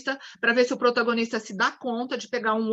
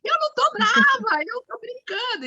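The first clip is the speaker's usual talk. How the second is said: very high, shrill-free mock cry of denial; exaggerated angry denial; 13 semitones higher than usual. Shown as a demonstration. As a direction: overplay a cranky woman insisting she's fine. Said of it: "Você acha que falar assim". (negação brava exagerada e aguda)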